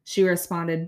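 ordinary talk

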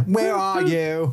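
drawn-out call. Retold or imitated. Imitating the call.

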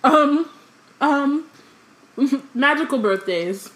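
eating chocolate while talking; mouth full. Real, not acted. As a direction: talk through a mouthful of chocolate. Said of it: "Sorry, I have chocolate in my mouth".

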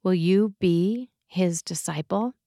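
ordinary talk, nothing marked

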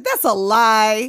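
loud, drawn-out denial; hammed-up outraged objection; mock indignant retort. Retelling the girl's denial to her dad, in mock indignation.

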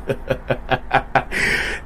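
breathy, voiceless run of chuckles; laughing. Real, not acted. Storyteller amused at his imagined accusers, laughing.

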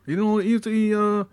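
high-pitched mock Italian voice; comic high Italian accent. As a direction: plead gratefully in a hammed-up Italian accent. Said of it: "That's my Italian voice".

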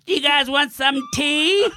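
high, slow call down the stairs; mom yelling down. Retold or imitated. Mom calling down to the basement, high and slow. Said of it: "His mom keeps yelling down".

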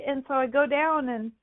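normal talking voice

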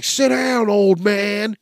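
high, loud mock command; loud pretend cop order. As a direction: bark it like a future cop with a laser. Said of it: "They're gonna be like".

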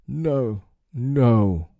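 high, pleading cry. A narrator's reading. Brave pirate cowering before his captain, crying out.

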